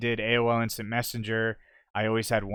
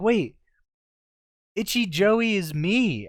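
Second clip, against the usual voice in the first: dawning shock; 10 semitones higher, 6 dB louder, slower than usual. Retold or imitated. Retold realization.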